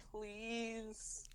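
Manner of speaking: high, soft drawn-out plea; pleading. Retold or imitated. Lincoln begging his parents on his knees, pleading. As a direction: beg like a kid on his knees. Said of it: "practically begging his parents".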